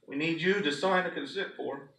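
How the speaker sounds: ordinary talk, nothing marked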